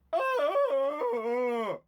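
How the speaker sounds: very high, loud yelp of frustration, imitating the boss; frustrated howl. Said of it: "different variations, different notes".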